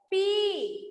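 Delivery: "पी" with the ee held long; long ee vowel. Shown as a demonstration. Teacher drilling the student, long-drawn "पी". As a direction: stretch out the vowel of "पी". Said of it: "लंबा हो जाता है".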